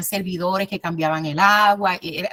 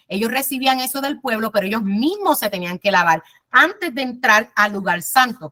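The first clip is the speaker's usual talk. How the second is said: ordinary delivery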